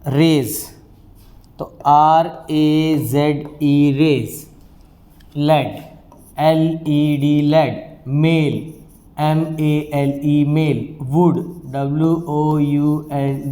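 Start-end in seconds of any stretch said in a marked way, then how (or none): 1.9-3.9 slow spelling. Shown as a demonstration. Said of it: "spelling out letters". 6.4-7.4 slow spelling. Shown as a demonstration. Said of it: "spelling out letters". 9.2-10.5 slow spelling. Shown as a demonstration. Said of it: "spelling out letters".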